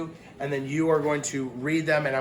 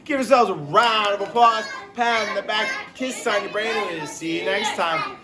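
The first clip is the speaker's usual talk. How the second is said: higher, louder upbeat sign-off; cheerful goodbye; 6 semitones higher, 4 dB louder than usual. Real, not acted. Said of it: "cheerful sign-off to class".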